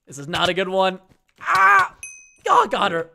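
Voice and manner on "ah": sharp yell. Real, not acted.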